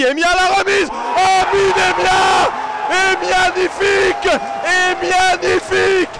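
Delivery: shouting the goal call, rising with joy; excited sports commentary. Real, not acted. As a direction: yell it like a goal just went in.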